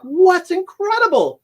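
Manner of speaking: high, slow incredulous friends; high mock disbelief. Friends to the teller, mock disbelief in a high drawn-out voice. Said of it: "They're like".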